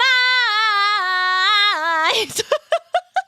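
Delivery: loud high laugh breaking into squeal. Real, not acted. Streamer cracks up at her own joke, squealing laugh. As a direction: burst out in a shrieking laugh. Laughing after sung line.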